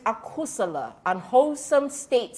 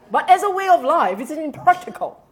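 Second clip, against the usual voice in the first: forceful; 6 semitones higher, 7 dB louder, faster than usual. Real, not acted. Presses the point hard.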